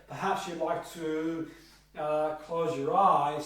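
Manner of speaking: slow, with a pause after "Perhaps you'd like to"; pause before the command. Shown as a demonstration. Hypnotherapist softening an instruction with a slow pause. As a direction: let "close your eyes" land after a beat. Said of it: "put a pause".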